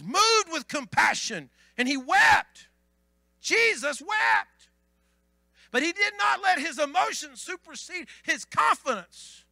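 loud, slow preaching. Acted. Pastor telling the congregation about Jesus, preaching loudly. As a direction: lean into it like a preacher.

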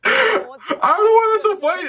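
high-pitched, slow, laughing words. Real, not acted. Viewer cracking up at the show, laughing. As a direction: talk through real laughter.

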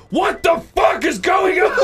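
high, drawn-out outraged shout; furious dad. Retold or imitated. Dad walking in on kid's TV show, furious shout. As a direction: yell it as an appalled father, slow and loud. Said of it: "he was so fucking pissed off".

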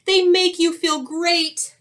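high, loud happy bear; bubbly storybook bear voice; cheerful bear. Acted. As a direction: sell it with big cheer. Happy bear urges hugs on skunk.

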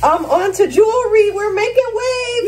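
high, sing-song cheer; high-pitched little hooray; excited celebrating. Real, not acted. Celebrating progress while getting ready.

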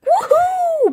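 a high, loud whoop of joy; excited whoop. Acted. Gamer hyping his character's gains, high whoop. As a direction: cheer like you just felt your abs grow.

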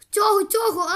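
urgent striking. Real, not acted. Player striking slimes, urgent and loud. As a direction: hammer out each target.

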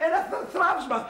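high, stammering shock; startled fright. Acted. Man startled by smashing in the house, high fright. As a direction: jump in pitch and stumble over the word. Frightened by flying breakable objects.

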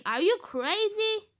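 shocked outburst. Retold or imitated. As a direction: yell it in disbelief. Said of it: "And you say".

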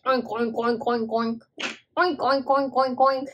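quick run of playful pig oinks; piggy voice. Shown as a demonstration. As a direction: oink hello like a little pig. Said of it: "should we say hello in Piggy voice?".

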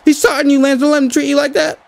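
high, pushy cheering on; urging partner. Real, not acted. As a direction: egg your partner on from the sidelines. Yelling at his partner Lance.

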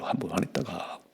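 soft whisper repeating the warning; whispered echo. Real, not acted. Pastor whispering his warning again to the congregation. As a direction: let it fall to a whisper.

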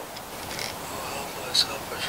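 high breathy ghost plea; ghostly wail. Retold or imitated. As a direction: moan it like a ghost. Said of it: "saying something".

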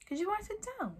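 put-on high, soft boy's voice; Harry suggesting. A narrator's reading. Harry helping Hagrid out, tentative. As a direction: offer it gently and high. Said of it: "Harry suggested".

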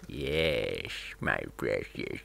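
low whispery "my precious" creature voice; Gollum voice. Retold or imitated. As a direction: coo over the treasure like Gollum. Hoarding ore like Gollum.

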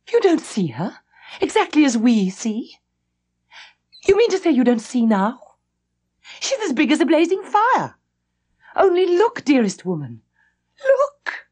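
high, urgent, almost crying; frantic pleading. A narrator's reading. Governess urging Mrs. Grose to look, frantic and high. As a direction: beg her to look, voice climbing.

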